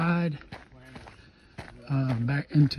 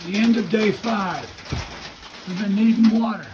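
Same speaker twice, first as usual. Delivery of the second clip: loud; 6 semitones higher, 7 dB louder than usual. Real, not acted. Talking over rain noise.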